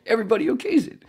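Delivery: high, laughing, fast disbelieving repeat; giddy laughing repeat, way up high; laughing disbelief. Real, not acted.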